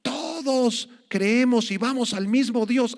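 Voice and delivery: high, mocking, slow; mock quote. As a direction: mimic the false preachers with a sneer. Mocking the ecumenical view. (burla aguda y lenta)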